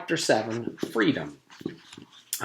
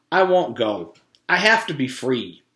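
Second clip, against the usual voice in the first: firm declaration; 3 semitones higher, 6 dB louder than usual. Acted. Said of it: "he declared".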